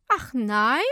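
loud, mock-questioning comeback; sarcastic retort. A narrator's reading. Woman answers the helper's question, loud and sarcastic. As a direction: toss it back loudly in scorn.

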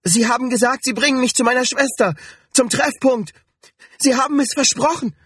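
very high, panicked accusation; distraught high-pitched protest; desperate protest. A narrator's reading. Desperate protest after crying out.

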